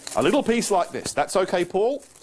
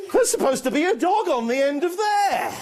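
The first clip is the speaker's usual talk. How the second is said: mock puzzlement; 10 semitones higher than usual. Magic dog trick goes wrong.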